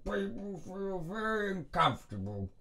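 Cockney gangster giving advice; in character as Alfie. Retold or imitated. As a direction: coach in the gangster's voice. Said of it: "Alfie also gets in people's personal space".

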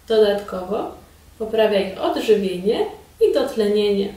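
ordinary talk, nothing marked

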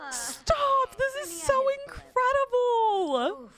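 high-pitched, drawn-out excitement; thrilled exclamation. Real, not acted. Player cheering her Sim's accolade, thrilled and high. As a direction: squeal the big win in delight.

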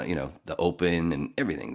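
ordinary talk, nothing marked

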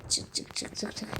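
hushed whisper, coaxing quickly; breathless whispered chant; whispered urging. Real, not acted. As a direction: whisper it tensely, willing her out. Urging character out of water.

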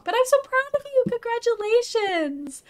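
high, excited gushing; proud congratulations. Real, not acted. Streamer telling a friend she's proud, excited. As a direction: cheer them on in a high voice.

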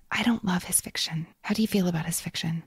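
hushed, breathy whisper on every word; whispered confession. Real, not acted. Admitting to her co-host she dislikes his fiction, whispered. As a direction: lean in and whisper it like a guilty secret.

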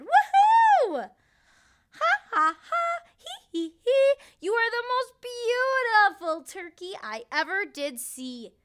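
high loud cheering; cheering crowd. Acted. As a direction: cheer him on loudly. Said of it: "they also cheered".

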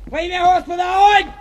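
high, loud prayer then fire order; shouted battle cry. Real, not acted. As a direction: yell it as the gun fires. Prayer and firing command. (громкий боевой клич)

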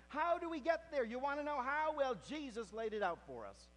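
high, loud preaching build; full-force preaching. Acted. Preacher telling the congregation of Elijah's cave, loudly. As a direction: drive the story home to the congregation.

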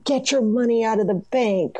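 high-pitched imitation of a panicked-crowd shout; retold shout, pitched high; mock shouting. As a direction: raise the pitch like someone shouting a warning, without much extra volume. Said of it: "kind of random people just shouting".

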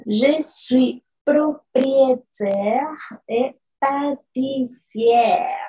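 je suis propriétaire et pâtissière spoken very slowly, syllable by syllable; slow French demo. Shown as a demonstration. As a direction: read the French sentence slowly for learners.

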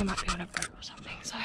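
quick hushed whisper so the boaters can't hear. Real, not acted. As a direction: breathe it out fast, no voice. People nearby, keeping quiet.

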